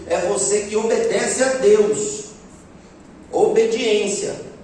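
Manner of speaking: slow, stressed "Obediência"; emphatic. Real, not acted. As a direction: land the key word slowly and firmly. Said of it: "sermon emphasis". (ênfase lenta em "Obediência")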